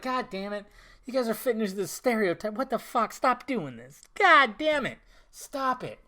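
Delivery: high-pitched exasperated scolding; frustrated. Acted. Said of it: "I'd be fucking frustrated too".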